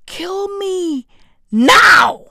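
drawn-out plea bursting into a loud yell; hammed-up wail, exploding on the last word; dramatic despair. Vlogger reacting to the proposal's finalization, drawn-out despairing yell. Mock despair at cabinet news.

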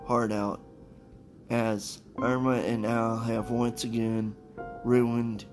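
ordinary delivery

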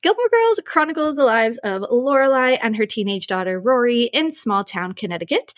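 ordinary talk, nothing marked

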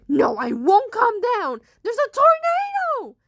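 very loud refusal climbing in pitch; panicked shouting. Acted.

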